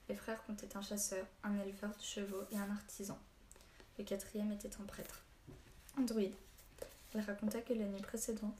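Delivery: ordinary delivery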